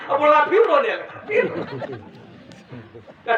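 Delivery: laughing while telling. Real, not acted. Preacher laughs at own story.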